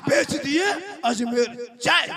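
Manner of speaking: loud defiant shout, slow at the end. Retold or imitated. Husband defiantly telling wife he sold the cart, shouting. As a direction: throw it back at her loudly.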